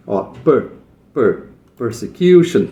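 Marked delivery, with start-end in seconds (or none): 1.1-2.6 "per, persecution" with "per" said alone first and given a light stress. Shown as a demonstration. Teacher showing students the light stress on "per" in "persecution". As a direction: tap "per" lightly before the word. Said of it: "Tem um pouquinho de estresse desse per".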